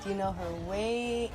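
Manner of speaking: slow, drawn-out operator questions; mock unhurried interrogation; imitated slow questioning. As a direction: plod through a checklist, dragging each question.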